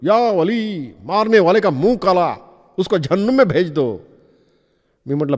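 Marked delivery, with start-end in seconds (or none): 0.0-4.0 higher, wailing curse to the heavens; a fakir cursing the ticket checker toward the station; crying out. Acted. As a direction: call out a fakir's curse, pitch raised. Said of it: "तो ओरडला".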